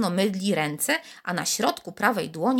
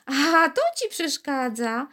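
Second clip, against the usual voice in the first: quiet silvery laugh; 7 semitones higher, slower than usual. A narrator's reading. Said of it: "śmiał się cichutko i srebrzyście".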